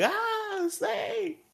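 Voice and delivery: shrill wail far above the voice; very high terrified cry. Retold or imitated. Zack crying out at the fake snake in mock terror. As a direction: cry out in cartoonish panic. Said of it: "he's like".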